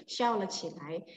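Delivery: ordinary delivery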